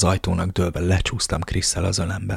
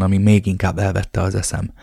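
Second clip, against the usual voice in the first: plain reading voice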